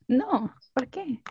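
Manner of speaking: ordinary delivery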